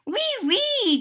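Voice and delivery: very high-pitched, delighted squeal; excited cry. A narrator's reading. Gigi agreeing in French, an excited cry. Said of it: "Gigi cried".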